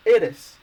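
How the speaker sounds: eres said loud in Spanish, stress on E; Spanish pronunciation. Shown as a demonstration. Teacher demonstrating eres to learners, loud and clear Spanish. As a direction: punch out eres, E-res. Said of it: "you're supposed to pronounce".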